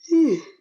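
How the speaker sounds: big, high, loud exhale of exhaustion; loud tired sigh. Real, not acted. Tired vlogger sighing mid-rant, loud weary sigh. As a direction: let out a heavy weary sigh. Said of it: "I'm really tired today".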